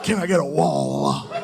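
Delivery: hammed-up church shout, last plea stretched; Holy Spirit fervour. Dad hit with the Holy Spirit watching news, fervent cry. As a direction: cry out like a worshipper overcome. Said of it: "he gets hit with the Holy Spirit".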